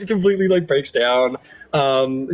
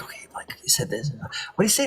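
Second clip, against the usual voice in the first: hushed eavesdropper's murmur; whispering; 8 dB quieter than usual. Acted. Two eavesdroppers piecing words together, whispered. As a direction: confer under your breath. Eavesdroppers conferring under their breath.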